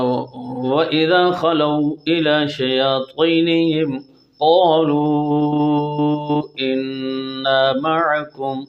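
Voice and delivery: slow melodic tajweed recitation; Quran recitation. Shown as a demonstration. Teacher reciting the verse in full for students. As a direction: chant the verse as tilawat.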